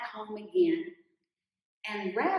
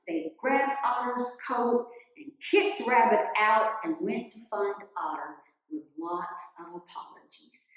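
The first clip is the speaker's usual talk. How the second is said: usual speaking voice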